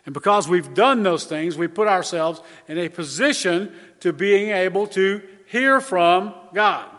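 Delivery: usual speaking voice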